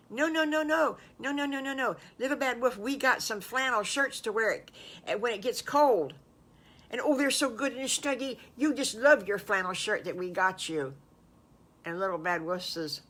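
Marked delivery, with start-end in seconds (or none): 0.1-10.9 high, lively protest settling into coaxing; eager reassuring friends. Acted. Friends calm wolf about flannel shirts, eagerly reassuring. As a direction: rush to reassure, pitched up.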